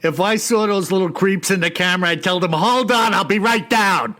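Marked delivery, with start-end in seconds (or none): 2.5-4.1 mock call-out. Acts out calling to creepy children.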